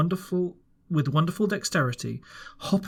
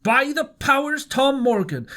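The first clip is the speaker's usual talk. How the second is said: high, louder outburst; exclaiming; 10 semitones higher, 4 dB louder than usual. A narrator's reading. Landlord scolding Tom Morgan, loud exclamation. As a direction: burst out at Morgan. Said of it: "exclaimed the landlord".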